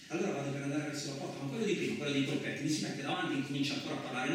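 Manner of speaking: ordinary delivery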